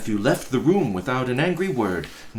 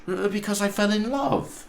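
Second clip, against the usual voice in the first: nephew answering; 12 semitones higher than usual. Acted.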